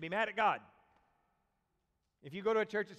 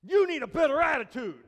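much louder, hard scolding; finger-pointing scold; 6 semitones higher, 13 dB louder than usual. Retold or imitated. Parent pointing at a child, loud scolding. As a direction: jab the words like a pointed finger. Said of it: "And they point and they".

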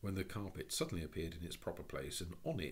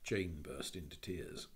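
usual speaking voice

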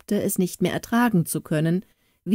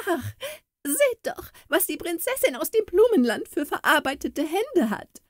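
high, shrill, jeering voice with laughter; shrill mocking court lady; 12 semitones higher than usual. A narrator's reading. A jealous court lady mocks Kala's work-worn hands, shrill and laughing. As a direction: sneer at her hands, voice pitched up high. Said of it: "rief mit schriller Stimme eine Hofdame".

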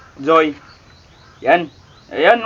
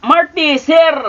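ordinary talk, nothing marked

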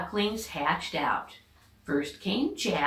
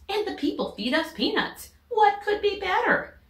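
pitched way up, thrilled; delighted duck wife; 3 dB louder than usual. Acted. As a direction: rise high with joy at the idea. Missus Mallard delighted.

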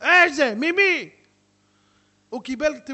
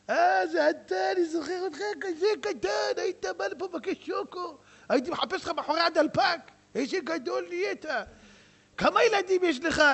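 high, lively shopkeeper voice; chatty old grocer; 10 semitones higher than usual. Acted. Old grocer greeting a customer he knew as a boy, high chatty voice. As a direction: gush over him like an old neighbour.

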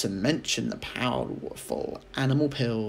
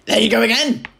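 put-on high, loud scolding old woman; cried out; 10 semitones higher, 12 dB louder, faster than usual. A narrator's reading. Grandma scolding George loudly. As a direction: cry it out in exasperation. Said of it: "she cried".

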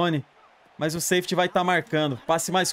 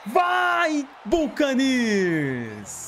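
excited shout; 7 semitones higher, much slower than usual. Real, not acted. Cheering a big play.

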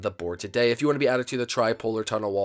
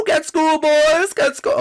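high, loud gloating shout; triumphant taunt; 8 dB louder than usual. Pretend. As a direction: crow it at the losers. Taunts opponents in game.

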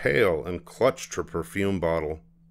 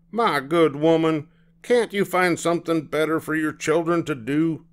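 annoyed; 9 semitones higher than usual. Acted. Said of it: "glared at Mama and said".

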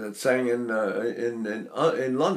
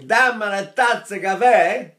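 lively Italian phrase, louder; Italian speech; 5 semitones higher, 5 dB louder than usual. Retold or imitated. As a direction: say it like a native Italian. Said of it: "an Italian who's saying".

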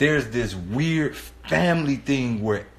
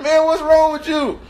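high, loud comic alarm; pitched-up exasperated question; incredulous demand; 13 semitones higher, 5 dB louder than usual. Retold or imitated. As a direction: confront a friend in disbelief. Confronting mumbling friend.

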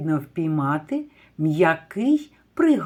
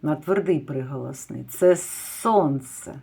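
normal talking voice

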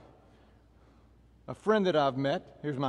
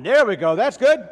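high, loud praise; loud encouragement; 5 semitones higher, 8 dB louder than usual. Real, not acted. Trainer praising the class after a countdown, loud encouragement. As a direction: call out approval to the class.

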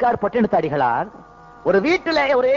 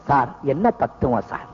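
low, hushed-awe fool voice; much lower pitch, mock-impressed; foolish admirer; 11 semitones lower than usual. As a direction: play a fool pretending to grasp deep philosophy. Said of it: "அசட்டு பைய தான் அசட்டு தனத்தை".

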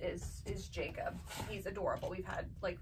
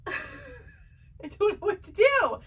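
high, slow, hammed-up crying wail; mock crying; 12 semitones higher, 6 dB louder, much slower than usual. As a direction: wail it like a fake sobbing child, stretched out and much higher. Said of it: "she's crying in the picture".

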